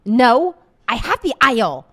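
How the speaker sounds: loud flat refusal; nasty lady refusing to move, shouting; mock shouting. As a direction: bark the no's at her. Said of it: "she said".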